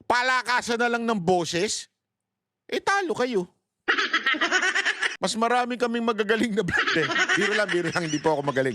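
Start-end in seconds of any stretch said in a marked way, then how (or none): 3.9-5.2 high giggle at his own boast; high laugh. Real, not acted. Vlogger laughing at his own boast about vloggers, high giggle. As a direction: laugh at your own bragging.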